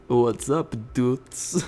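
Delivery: slow, put-on smooth-talker voice; mock charmer. Artyom turning on the charms for the cabin leader, mock suave. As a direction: lay on the charm, unhurried and cool. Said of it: "Artyom's turning on the charms here".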